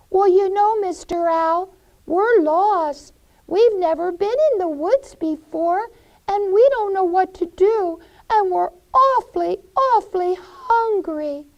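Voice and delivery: put-on high, loud kid voices; lost children pleading with the owl. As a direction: beg the owl for help.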